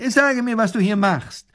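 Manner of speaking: high, loud demand; annoyed professor. A narrator's reading. Professor questioning the soldier, high and stern. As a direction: demand it like a fussy superior.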